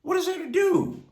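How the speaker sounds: a preacher's high pleading question; pleading rhetorical question. Acted. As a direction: throw the question up high, searching.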